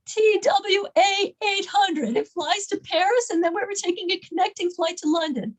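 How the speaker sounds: high-pitched imitated passenger; upset, retold. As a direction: list the flight as a grievance. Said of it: "she was so upset".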